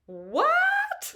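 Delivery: very high, loud incredulous what; can't believe your ears; shocked disbelief. Retold or imitated. Friend's insecurity, disbelief.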